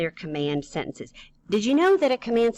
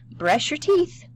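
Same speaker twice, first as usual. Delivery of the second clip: high, loud read-out ending on a rise; example command; 10 semitones higher, 4 dB louder, slower than usual. Shown as a demonstration. Teacher reads an example for the class, high and rising. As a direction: read it out high with a questioning lift.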